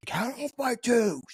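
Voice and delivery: very high, drawn-out callout; mock instructor call. Instructor to trainees amid scattered gear, high mock callout. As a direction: stretch it out slowly, way up high. Imitates instructor calling out the count.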